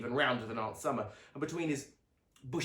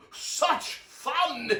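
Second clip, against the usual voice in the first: high chuckle into the words; chuckling; 7 dB louder than usual. A narrator's reading. Uncle Merriweather chuckles to the family, laughing. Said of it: "chuckled Uncle Merriweather".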